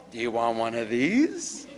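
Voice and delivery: low, light cheerful offer as if nothing happened; bright fresh offer. Shown as a demonstration. Caregiver offering a treat to a calmed patient, breezy. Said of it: "as if that whole thing never happened".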